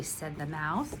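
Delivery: plain reading voice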